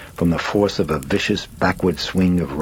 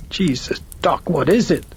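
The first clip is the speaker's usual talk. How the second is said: rising panic; 12 semitones higher than usual. A narrator's reading. Panicked mother pleads with doctor.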